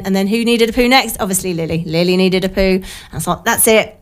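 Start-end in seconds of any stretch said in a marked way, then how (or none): none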